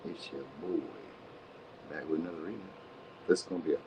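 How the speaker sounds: muffled and far away, no mic plugged in; no mic yet. Real, not acted. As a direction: sound distant, like talking past a missing mic. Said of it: "I ain't even got my motherfucking mic in".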